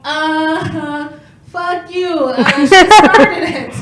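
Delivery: a loud, slow, defiant brush-off; mock defiant retort. Comedian snapping back at her critics, mock defiant. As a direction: throw the blame back with a grin. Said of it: "you know what I tell those people".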